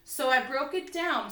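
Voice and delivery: ordinary talk, nothing marked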